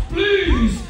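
high, drawn-out plea; begging. A narrator's reading. Elephant begging for the small folks, pleading. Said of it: "and begged".

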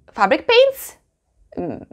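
high-pitched, baffled question; puzzled artist. Acted. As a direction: play an artist caught off guard, voice leaping up. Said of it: "if you ask any artist".

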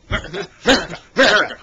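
mock American, chanted with a growl on the R; exaggerated American R; hammed-up American accent. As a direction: ham up an American accent, word by word. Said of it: "hamming up his America".

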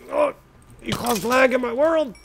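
high, loud indignant yell; hammed-up angry shout; mock outrage. Real, not acted. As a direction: scold the mob for lagging the game. Annoyed at the mob.

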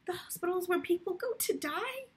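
high, tearful pleading breaking into tears; starting to cry. Acted. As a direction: let the fear of the hospital push her voice up toward crying. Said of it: "she began to cry".

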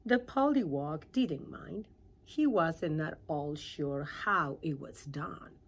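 ordinary delivery

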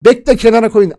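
normal talking voice

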